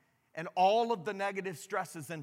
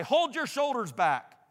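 stern reply; 6 semitones higher, 4 dB louder than usual. Acted. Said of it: "I frowned and said in reply".

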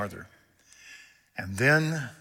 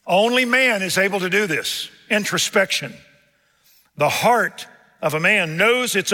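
high, loud pulpit delivery; forceful preaching; 7 semitones higher, 8 dB louder than usual. Real, not acted. Preacher expounding a verse to the congregation, forceful preaching. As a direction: drive each phrase home from the pulpit.

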